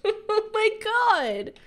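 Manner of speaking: high, loud, long wail breaking out of a laugh; exasperated wail. Real, not acted. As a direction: wail it slowly, half laughing. Exasperated at game failure.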